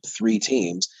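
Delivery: ordinary talk, nothing marked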